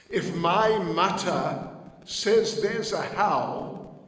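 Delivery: slow, loud and certain; a child firmly trusting his mother; little Krishna speaking up for his mother; boy's earnest declaration. Acted.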